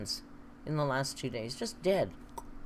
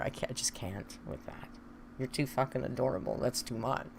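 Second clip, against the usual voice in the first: soft, breathy overwhelmed aside; overwhelmed hush; 4 semitones lower, 5 dB quieter than usual. Real, not acted. Streamer melts softly over a viewer's sweetness. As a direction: melt quietly over something too cute.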